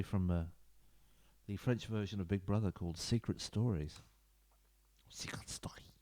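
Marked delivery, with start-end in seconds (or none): 5.1-5.9 unvoiced whisper, much softer; whispered correction. Real, not acted. Whispering a correction of the show's title. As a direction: quickly whisper the fix.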